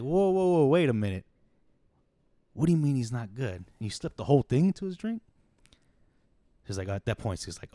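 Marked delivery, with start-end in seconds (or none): 0.0-5.2 higher, louder "whoa" with startled alarm; alarmed disbelief. Retold or imitated. Pimp stunned she dosed the john with everything, alarmed. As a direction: stop her in shock, half shouting. Pimp hears the whole bag went in.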